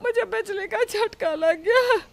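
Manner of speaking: high, startled yelp of pain; shocked cry. Acted. Mother-in-law jolted by the mixer, high startled cry. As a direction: jolt up as if the current just hit you.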